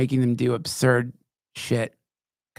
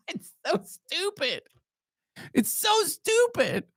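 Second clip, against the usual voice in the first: squeaky laugh-through-words, slow; laughing at how stupid it is, high and slow; 4 dB quieter, slower than usual. Real, not acted. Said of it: "laughing high mock voice".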